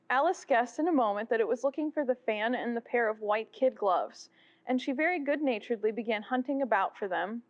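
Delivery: usual speaking voice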